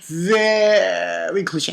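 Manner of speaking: "Very" stretched right out, slowly; exaggerated drawl. Real, not acted. Reviewer hammering how cliche the villain is, exaggerated drawl. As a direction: drag out "Very" to hammer the point.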